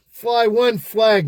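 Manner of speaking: high, loud and slow, like a rallying cry; emphatic declaration. Real, not acted. To his co-host about Peterborough, a slow rallying shout. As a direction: plant the flag with a slow proud shout.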